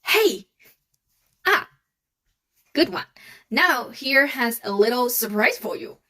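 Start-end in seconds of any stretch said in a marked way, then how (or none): 0.0-0.5 loud, high "Hey" dropping fast; short, emphatic falling "Hey"; falling fourth tone. Shown as a demonstration. 1.5-1.7 short "Ah" plunging downward; brisk falling "Ah"; falling fourth tone. Shown as a demonstration. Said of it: "The fourth tone is the one dropping down".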